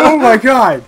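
high, loud words through laughter; laughing exclamation. Real, not acted. Player laughing at a teammate's scream, loud laughing cry. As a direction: crack up at the chaos.

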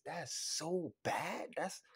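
ordinary talk, nothing marked

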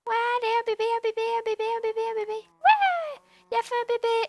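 put-on high, chanting cheer for a baby; excited cheering. Encourage les animaux. (encouragement aiguë et joyeuse)

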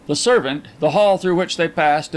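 plain reading voice

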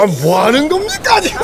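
high, loud disbelieving cry; surprised outcry. Real, not acted. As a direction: exclaim at what just happened. Reacting to a wild rally.

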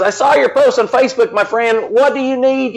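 high-pitched imitation of an East Coast accent; Dan Carroll. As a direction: put on the friend's accent, pitched well up. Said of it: "he's got this really great accent".

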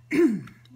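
sharp loud clearing of throat. Real, not acted. Throat hit by emotion.

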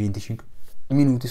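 hiccupping mid-sentence; talking through a hiccup. Real, not acted. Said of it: "scusate il singhiozzo".

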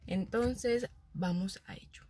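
soft unvoiced whisper, slowing down. Real, not acted. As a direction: breathe the words out quietly. Whispered sign-off in the supermarket.

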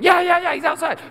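put-on very high, loud kid shout; excited children. Children rush outside after missing boy, shrieky excitement. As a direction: yell it like a pack of children.

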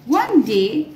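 ordinary delivery, nothing marked

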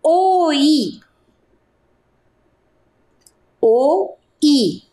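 "oi", then "O I" split into two vowels; dictating "oi" sound by sound; dictation. Shown as a demonstration.

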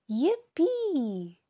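high happy yippee; excited cheer. Acted. Mouse cheering on the leaf pile, high excited cry. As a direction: cheer like a thrilled little mouse.

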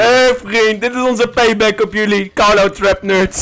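triumphant shout. Real, not acted. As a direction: call out the payback, loud and gloating. Announcing payback to trapped players.

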